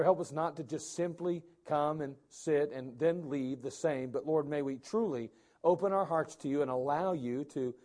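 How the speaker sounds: hushed, earnest prayer voice. Real, not acted. Pastor leading the church in prayer, earnest and hushed. Pastor prays before sermon.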